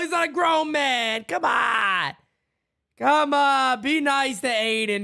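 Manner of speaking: ordinary talk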